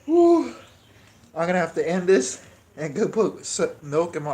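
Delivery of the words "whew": high, loud gasping whew; pained whew. Real, not acted. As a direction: blow out the heat. Burning pain from hot pepper.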